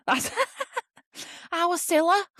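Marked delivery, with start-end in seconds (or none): 1.5-2.2 high-pitched Scouse impression; hammed-up Scouse catchphrase; Scouse accent. Shown as a demonstration. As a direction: put on a big Liverpool twang, high. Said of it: "I can do a Scouse accent usually".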